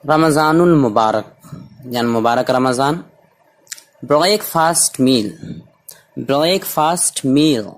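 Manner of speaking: normal talking voice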